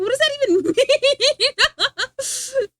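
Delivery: high, loud laugh breaking through the words. Real, not acted. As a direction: laugh out loud at the chat question. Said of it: "reads chat, cracks up".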